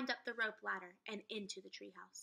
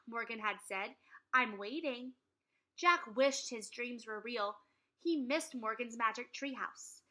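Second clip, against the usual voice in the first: story narration; 5 dB louder than usual. A narrator's reading. Reading chapter to class.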